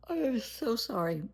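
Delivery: stretched high through a yawn. Real, not acted. Apologizing to viewers mid-yawn, yawning. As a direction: talk through a real yawn. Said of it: "I apologize for yawning".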